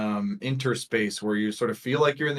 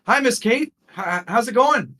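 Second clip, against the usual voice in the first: high, loud hello across a room; friendly greeting; 13 semitones higher, 8 dB louder than usual. Acted. Guide calling to Miss Kate in classroom, friendly. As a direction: call out brightly to an acquaintance.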